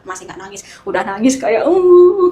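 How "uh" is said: put-on loud, high wail like a crying child; imitating her own childish crying; mock childish sob. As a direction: burst into a hammed-up kid's sob. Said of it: "kayak anak kecil".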